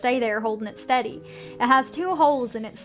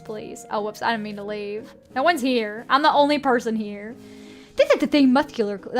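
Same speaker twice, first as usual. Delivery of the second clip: normal talking voice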